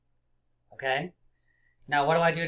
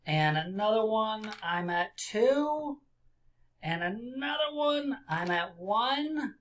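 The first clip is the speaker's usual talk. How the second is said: rising pitch; 7 semitones higher, slower than usual. Acted. Counting down with rising voice.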